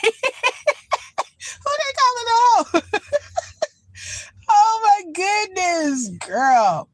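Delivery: high, slow laughing mock offence; laughing outrage. Real, not acted. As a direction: drag it out through giggles, high up.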